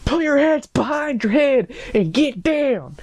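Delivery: high-pitched, strained mock scream; screamed police order. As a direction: scream it like an over-the-top cop. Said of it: "and screamed".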